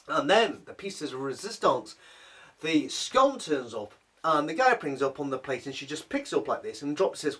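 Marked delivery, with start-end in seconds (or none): none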